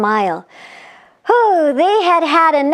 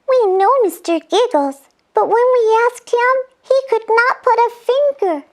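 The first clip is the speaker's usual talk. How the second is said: a lightly voiced eager fairy, a little quick; fairy Cora chiming in high; 8 semitones higher than usual. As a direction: lift the voice up into a bright little fairy.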